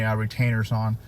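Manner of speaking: ordinary delivery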